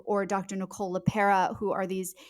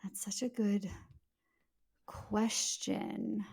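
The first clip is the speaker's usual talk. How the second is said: whisper; 8 dB quieter, slower than usual. Real, not acted. Whispered aside.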